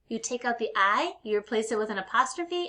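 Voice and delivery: usual speaking voice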